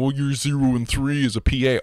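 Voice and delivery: lightly mocking whine; mocking teammate. Teammate flaming the P A player, mild mock whine. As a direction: sneer at a struggling teammate. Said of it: "they'll automatically start going".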